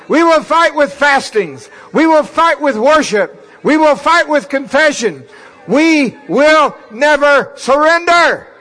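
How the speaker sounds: high, loud shouted praise; roaring rallying speech. Real, not acted. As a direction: roar it out to the church. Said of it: "Now let's praise him".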